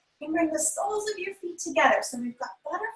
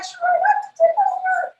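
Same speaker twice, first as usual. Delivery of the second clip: high, loud playful warning; mock alarm; 5 dB louder than usual. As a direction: squeal a pretend warning about tipping. Pretend balloon tipping over.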